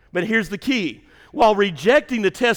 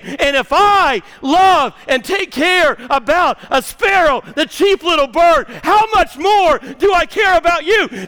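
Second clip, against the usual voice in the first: loud, high, slowed preaching as Jesus; full-force preaching; 7 semitones higher, 7 dB louder than usual. Acted. Pastor preaching Jesus' care to the congregation, loud and high. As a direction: thunder Jesus' promise to the congregation.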